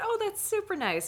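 very high, strained politeness; bitter fake niceness. Fan hearing of someone else's signed books, bitterly sweet. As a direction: coo it with envy underneath. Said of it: "I'm starting to get bitter".

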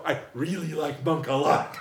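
slowed-down, over-earnest fan; slow, deliberate backpedal to save the chat; embarrassed fan trying to save the moment, said slowly; slow awkward rescue. Retold or imitated. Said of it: "only way I could have to rescue".

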